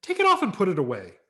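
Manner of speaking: fast, loud, high order; stern mother. Acted. Mother ordering her son to remove the mask, fast and stern. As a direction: snap out the command. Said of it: "my mother said".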